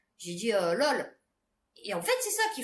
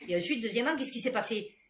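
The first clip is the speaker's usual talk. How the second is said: ordinary delivery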